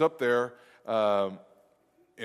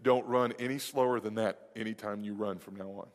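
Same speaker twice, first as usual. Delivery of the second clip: retold calm instruction; 5 semitones lower, 6 dB quieter than usual. Dad's calm answer after the race.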